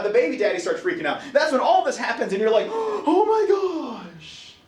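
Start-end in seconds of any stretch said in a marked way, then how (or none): 2.7-4.5 gasp, then high drawn-out panic; mock freak-out. Dad when labour starts, mock freak-out. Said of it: "dad starts freaking out".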